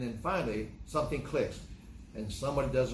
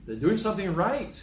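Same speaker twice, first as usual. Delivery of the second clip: delighted; 7 semitones higher, 6 dB louder than usual. Retold or imitated. Delight at the kids.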